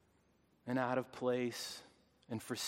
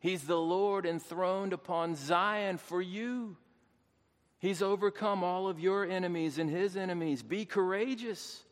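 raised, ringing pulpit proclamation; exultant preaching; 5 semitones higher than usual. Real, not acted. Preacher telling believers the Lord has overcome, exultant preaching. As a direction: declare the victory with lifted pitch and firm conviction.

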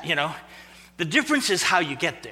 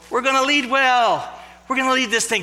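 higher, louder, puffed-up boast; mock bravado; 7 semitones higher, 4 dB louder than usual. Preacher voicing James and John boasting about leading, puffed-up and louder. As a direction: crow it like an eager disciple sure of glory.

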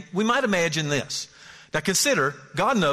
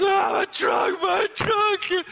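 put-on high shouting child's wail over his truck; mock kid crying out for his toy; upset little boy; much slower than usual. Boy whose toy truck got stepped on, wailing high. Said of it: "and the boy goes".

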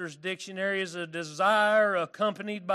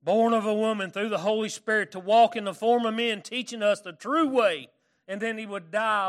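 preaching at full force; 3 semitones higher, 7 dB louder than usual. Acted. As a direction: drive the point home from the pulpit. Preaching the gospel story.

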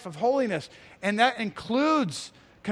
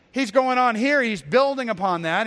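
ordinary delivery

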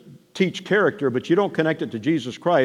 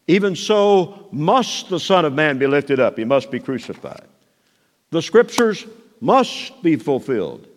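authoritative quoting; 3 semitones higher than usual. Acted. Preacher voicing Jesus' sayings.

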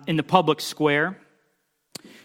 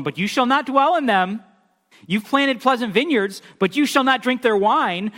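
raised, pressing pulpit reading; forceful scripture reading; 5 semitones higher, 3 dB louder than usual. Acted. Preacher reading Amos's judgment aloud, full force. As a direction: proclaim the judgment over them.

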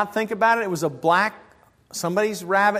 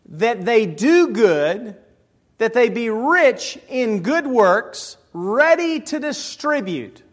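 slow, deliberate recitation; measured preacher reading; scripture reading; 3 semitones higher, slower than usual. Real, not acted. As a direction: read the verse with weight.

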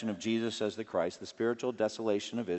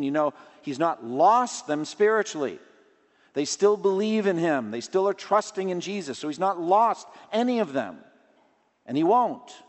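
raised, ringing sermon voice, higher and louder; preaching at full force; 8 semitones higher, 6 dB louder than usual. Acted. Pastor urging the congregation to flee temptation, preaching loudly. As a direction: drive it home from the pulpit. Preaching on Jesus keeping disciples.

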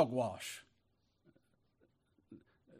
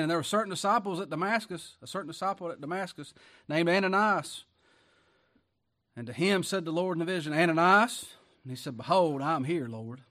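loud, raised preacher's reading voice; forceful scripture reading; 3 semitones higher, 7 dB louder than usual. Acted. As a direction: proclaim the verse, pitched up and ringing out. Reads scripture aloud forcefully.

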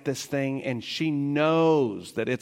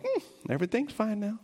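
put-on contented toddler; 9 semitones higher than usual. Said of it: "she pops it in her mouth like".

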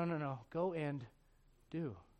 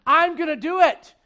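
loud declaration; 7 semitones higher, 13 dB louder than usual. Acted. Preacher's climactic declaration.

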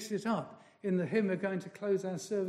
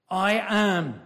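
"I am" louder, held as a title; majestic I am; 5 dB louder than usual. Shown as a demonstration. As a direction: proclaim "I am" like a divine name. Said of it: "immutable God".

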